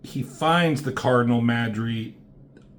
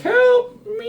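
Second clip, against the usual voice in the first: put-on a tiny kid's cry, pitched far higher and louder; child calling for help; 6 dB louder than usual. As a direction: squeak it like a trapped little child pleading. Trapped kid calling for help.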